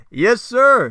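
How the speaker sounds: loud, high yes sir; yelling. Acted. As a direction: bellow it obediently. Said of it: "yelling at the top of his lungs".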